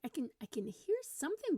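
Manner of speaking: high mock puzzlement; pretend searching. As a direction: strain to place a mystery sound, playacting. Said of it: "So I did the".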